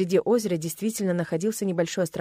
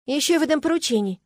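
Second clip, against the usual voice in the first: stern, raised voice; 8 semitones higher, 4 dB louder than usual. A narrator's reading. Heroine threatens squirrels with chores, stern. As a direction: lay it down strictly. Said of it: "строгим тоном добавила я".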